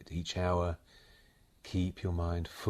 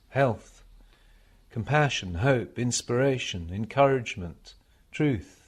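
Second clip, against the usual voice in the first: measured devotional recitation, higher and louder than chat; meditation reading; 7 semitones higher, 9 dB louder, slower than usual. Acted. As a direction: read it as a solemn meditation, pausing between phrases. Reads received passage as a meditation.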